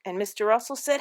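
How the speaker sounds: usual speaking voice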